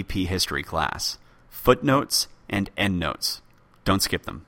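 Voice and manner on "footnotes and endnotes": "Footnotes" stressed, slowly spelled out; slow emphatic repeat. Shown as a demonstration. Telling daughter Zoe, slow and emphatic. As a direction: hammer it home slowly.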